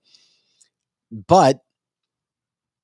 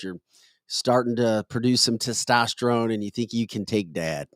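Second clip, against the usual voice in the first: usual speaking voice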